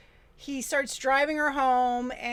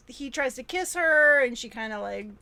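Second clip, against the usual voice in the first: ordinary delivery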